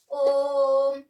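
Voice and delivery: ओम sung out long and loud, the ओ held for three beats; long drawn Om. Shown as a demonstration. Teacher demonstrating the long vowel to pupils, drawn-out chanted ओम. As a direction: hold the ओ in ओम for three counts, loud. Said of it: "अब आप ओम बोलिए".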